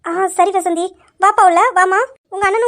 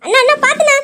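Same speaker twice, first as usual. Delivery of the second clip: sudden high, loud warning cry; startled shout of warning; alarmed shout; 8 semitones higher, 5 dB louder than usual. Acted. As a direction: yell to stop him in time. Warning brother about a car.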